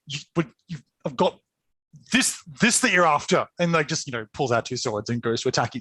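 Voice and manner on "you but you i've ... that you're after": flubbed retort. Acted. Stressed Martian attempting a witty retort, fumbling and halting. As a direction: trip over the words, restart, then push it out. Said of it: "flubs it and says".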